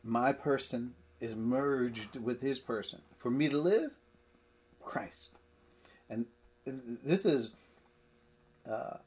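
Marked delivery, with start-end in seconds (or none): 3.2-5.3 LIVE raised, pause, then CHRIST alone; emphatic omission. Shown as a demonstration. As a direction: hang on LIVE, then drop CHRIST as a single word. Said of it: "So it should say, in the Greek it says".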